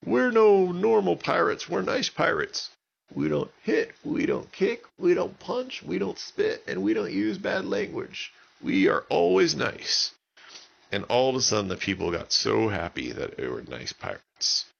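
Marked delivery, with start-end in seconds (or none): 0.0-10.1 friendly pirate yell. A narrator's reading. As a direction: call out cheerfully from the boat. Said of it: "he yelled".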